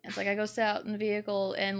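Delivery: ordinary delivery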